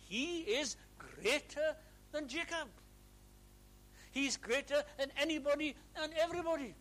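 loud, high exultant preaching; preaching at full force. Real, not acted. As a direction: build to a triumphant proclamation. Preaching climax.

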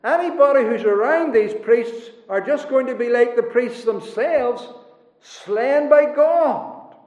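high, urgent preaching with drawn-out ending; forceful preaching. Real, not acted. Preacher warning the congregation about apostate priests, forceful preaching. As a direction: preach it high, stretching the final verdict.

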